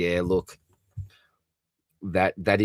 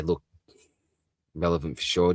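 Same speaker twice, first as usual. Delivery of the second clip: ordinary talk, nothing marked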